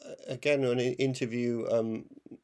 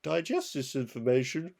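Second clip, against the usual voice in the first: higher-pitched posh comic-strip gent; posh pugilist; 6 semitones higher than usual. Acted. Friend imitates Viz character starting a fight, posh politeness. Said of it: "the posh guy who always tries to start a fight".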